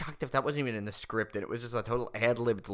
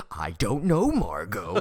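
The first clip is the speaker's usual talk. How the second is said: loud, drawn-out line delivery; loud movie quote; 7 dB louder, slower than usual. Acted. Friend quoting a favorite movie line, loud and slow. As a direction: belt it out slowly, hamming it up.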